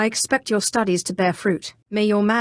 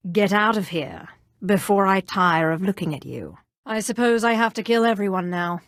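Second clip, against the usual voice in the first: ordinary delivery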